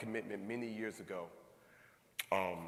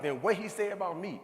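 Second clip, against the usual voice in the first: sassy challenging wife; 6 semitones higher, 7 dB louder than usual. Retold or imitated. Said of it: "She's like".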